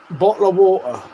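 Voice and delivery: English accent, "Bottle of water" with the Ts dropped to glottal stops; English accent, "Bottle of water" with no Ts, glottal stops instead. Shown as a demonstration. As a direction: say "Bottle of water" the London way, no T sounds. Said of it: "prove my Englishness by saying that without ever saying, uh, the letter T".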